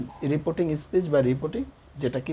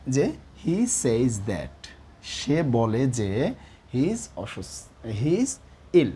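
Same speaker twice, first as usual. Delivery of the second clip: slow dictation; much slower than usual. Shown as a demonstration. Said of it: "কীভাবে?".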